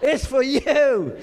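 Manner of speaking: playful shouted summons, pitch jumping up. Pretend. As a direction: call out like God summoning Stuart. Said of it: "just go".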